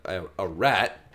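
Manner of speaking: ordinary talk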